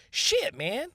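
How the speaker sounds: high falsetto "Shit, man"; high squeaky shit. Shown as a demonstration. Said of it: "you can say it a lot of different ways".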